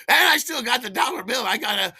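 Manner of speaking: high, loud crowing; gleeful triumph. Retold or imitated. Storyteller boasting of a cheap dime bag, gleeful triumph. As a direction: crow over your lucky win. Gloating over the escape, laughing after.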